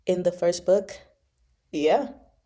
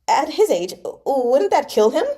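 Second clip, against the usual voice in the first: high, louder startled blurt; blurted in alarm; 7 semitones higher, 5 dB louder than usual. Acted. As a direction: blurt it out, caught off guard. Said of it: "Li Jianheng blurted".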